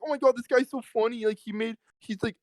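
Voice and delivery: high, gushing mock admiration; mocking everyone. Mocking everyone who finds Tyreek funny, high and gushing. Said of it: "And everyone's like".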